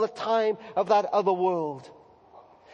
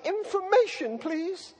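put-on a lightly higher small boy asking; little boy's voice; 8 semitones higher, 5 dB quieter, slower than usual. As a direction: ask shyly like a small child. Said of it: "I wind it up and I say".

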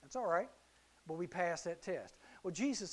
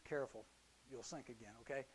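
soft warning; 7 semitones lower, 14 dB quieter than usual. Acted. Jesus warning Peter gently.

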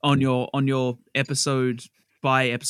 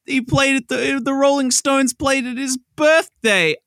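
much higher and slow, mock-amazed; high hammed-up voice; 9 semitones higher, 4 dB louder, slower than usual. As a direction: squeal it like a cartoon gag. Running gag punchline, high voice.

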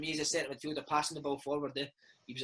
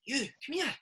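higher-pitched mock coach calling a player over; imitating Lenny; 9 semitones higher than usual. Reserve manager calling the young player over, higher imitated voice. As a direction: beckon him in a raised impression of the manager. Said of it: "and he'd be like".

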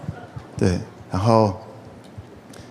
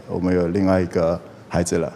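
lower, softer deadpan news; cold, flat announcement; 7 semitones lower, 7 dB quieter than usual. Retold or imitated. Bassist telling the family about his second child, coldly. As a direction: drop it without any joy. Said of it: "就这样冷冷的".

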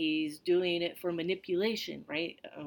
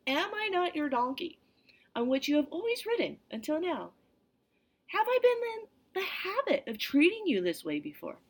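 higher, wounded donkey asking; pleading donkey voice; 9 semitones higher than usual. Acted. The donkey pleading with Balaam, in a lightly raised voice.

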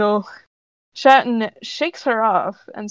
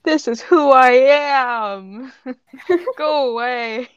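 put-on slow, melodramatic teenage wail; hammed-up misunderstood-teen protest; mock emo teen; 3 semitones higher, 4 dB louder, much slower than usual. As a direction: whine it slowly, playing it for laughs. Mocks character as emo teenager.

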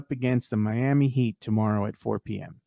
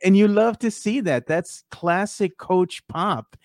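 delighted; 6 semitones higher, slower than usual. Real, not acted. Delighted praise of coach.

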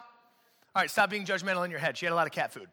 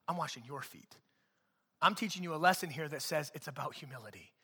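quiet Jesus quote; 6 semitones lower, 9 dB quieter than usual. Retold or imitated. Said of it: "He says".

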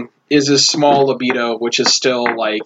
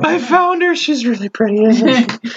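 put-on high slurring drunk voice; town drunk; 8 semitones higher than usual. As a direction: gush like a lovesick drunk. Drunk Bartholomew keeps talking.

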